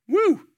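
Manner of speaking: high, loud "woo" of being taken aback; high whoop. Acted. Preacher reacting to a tough verse, high woo. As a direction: let out a high-pitched whew.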